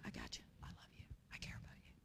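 soft whisper, very quiet; gentle whisper. Pretend. God speaking to the heart, soft whisper. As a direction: whisper it tenderly like God reassuring. God's gentle voice amid chaos.

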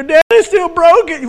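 put-on high, loud, slow whiny kid voice; child complaining. Child telling dad the toy is still broken, whiny. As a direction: play the kid who kept the pieces. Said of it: "And they go".

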